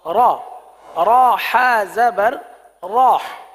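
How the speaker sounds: را said loud with mouth wide open; کھلی زبر. Shown as a demonstration. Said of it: "منہ اور آواز کھول کر".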